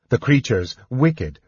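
ordinary delivery, nothing marked

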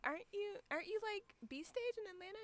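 high, soft pointed question; mock skeptical. Teasing friend about her great seats, high and soft. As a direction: call out her good seats. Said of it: "I'm like".

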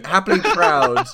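loud, high and slow through laughter; laughing. Real, not acted. Game master narrating a player's return, laughing. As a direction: laugh loudly as you draw out the words.

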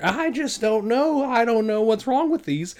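drawn-out, baffled little voice; helpless cashier voice. Retold or imitated. Imitating the clueless cashier, high and slow. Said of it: "So she's sitting there".

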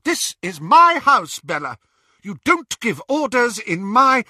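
high, loud indignant protest. A narrator's reading. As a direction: stand up to her sister. Narcissa protests.

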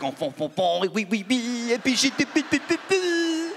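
mock the French, rising to a squeak; mock French gibberish. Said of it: "it sounds like they're just mocking each other".

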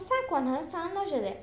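ordinary delivery, nothing marked

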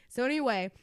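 normal talking voice

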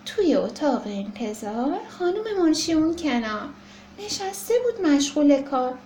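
ordinary delivery

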